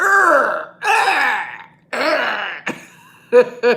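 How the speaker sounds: loud, hammed-up weightlifter grunts; yelling for a busy gym, mock lifter roars. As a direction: roar like a showoff at the gym. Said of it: "be like".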